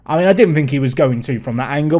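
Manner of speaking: ordinary talk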